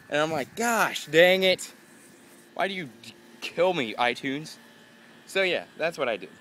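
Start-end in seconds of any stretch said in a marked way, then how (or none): 0.6-4.6 high, loud exasperated groan at iTunes; hammed-up high despair aimed at iTunes; mock frustrated outburst. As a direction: vent at the program like it betrayed you. Cursing iTunes in retelling.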